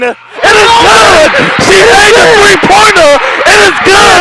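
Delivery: screaming high with pure joy; ecstatic shout. Real, not acted. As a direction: yell it like the winning shot just dropped. Buzzer-beater goes in.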